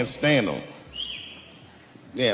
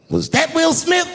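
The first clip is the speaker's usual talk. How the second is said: high cheeky question to the crowd; mock Chris Rock quip; 13 semitones higher than usual. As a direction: lob it up high and sly. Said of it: "looks at the crowd and goes".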